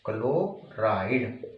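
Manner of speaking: Chloride drawn out slowly and softly; slow dictation. Shown as a demonstration. Teacher dictating to students, repeats chloride slowly and softly. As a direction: spell out chloride slowly for notes. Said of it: "इसे chloride बोलेंगे".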